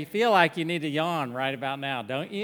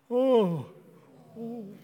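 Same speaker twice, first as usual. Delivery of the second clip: big put-on yawn on request; fake yawn; 6 semitones higher than usual. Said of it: "Let's all rip a fake yawn".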